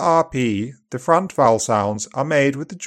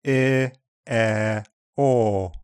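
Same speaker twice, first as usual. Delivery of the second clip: ordinary delivery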